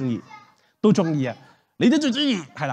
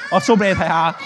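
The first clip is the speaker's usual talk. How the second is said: ordinary talk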